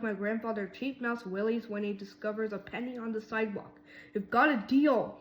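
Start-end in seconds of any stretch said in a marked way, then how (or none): 4.1-5.2 squeaky, louder character voice; a bright squeak of a deal. A narrator's reading. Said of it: "he squeaked".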